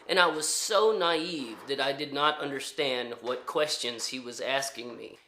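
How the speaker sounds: usual speaking voice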